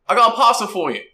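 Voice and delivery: high, loud cockney urchin call; a street kid hailing the line bull; cheeky street kid. Acted. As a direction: shout up at him like a grubby street kid.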